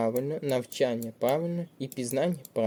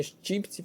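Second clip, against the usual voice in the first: щіпці with щіп stressed and pitched up; stress on first syllable; 6 semitones higher, 6 dB quieter than usual. Shown as a demonstration. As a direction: hit the first vowel of щіпці. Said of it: "падає наголос на перший склад".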